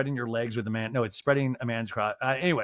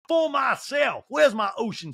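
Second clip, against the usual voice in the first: high shouted repeat; shouted mocking echo; mock outburst; 11 semitones higher, slower than usual. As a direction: bellow the phrase back in mockery. Mocking Sutton's standard.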